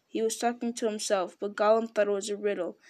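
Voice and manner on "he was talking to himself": ordinary delivery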